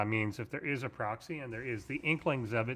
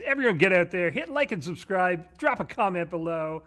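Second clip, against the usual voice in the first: hyped, higher, louder YouTuber pitch; 7 semitones higher, 7 dB louder than usual. Pretend. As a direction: pump it up like a YouTube host. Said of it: "Put on the YouTuber voice".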